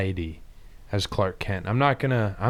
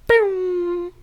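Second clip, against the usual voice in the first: boom. Acted. Superman takes off.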